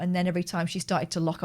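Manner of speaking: ordinary talk, nothing marked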